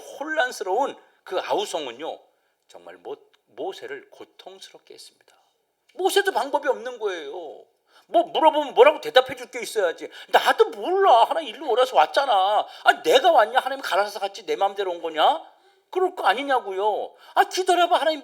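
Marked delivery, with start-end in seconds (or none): 2.7-5.4 hushed unvoiced whisper for effect; sermon whisper. Real, not acted. Preacher drops to whisper. 10.3-15.4 mock exasperated leader; exasperated Moses.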